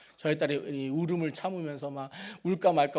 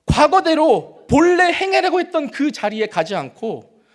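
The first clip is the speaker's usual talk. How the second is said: higher, louder preaching that keeps building; impassioned preaching; 8 semitones higher, 9 dB louder than usual. Acted. Pastor urging the congregation, rising impassioned preaching. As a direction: pour it out from the pulpit, rising each line.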